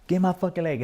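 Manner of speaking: high, loud urgent demand; desperate shout. Retold or imitated. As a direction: yell at them to grab it. Shouting to the guys.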